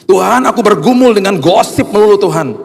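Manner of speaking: high, hammed-up wail to God; pleading prayer. Believer confessing gossip to God, acted high anguished plea.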